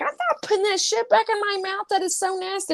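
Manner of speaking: high-pitched squeamish refusal; mock disgust. Refusal acted out in story.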